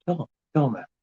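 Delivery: ordinary delivery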